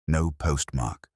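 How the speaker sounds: usual speaking voice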